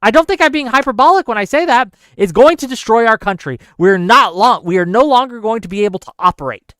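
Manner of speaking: loud, high, pressing each word; real alarm, hammering the point home; passionate. Real, not acted. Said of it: "a little passionate".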